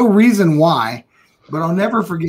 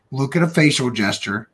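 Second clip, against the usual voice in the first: ordinary delivery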